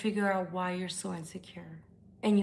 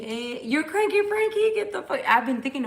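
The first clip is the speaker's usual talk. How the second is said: excited greeting; 7 semitones higher, 7 dB louder than usual. Real, not acted. Streamer greeting a returning viewer, excited high squeal. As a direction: squeal his nickname with delight.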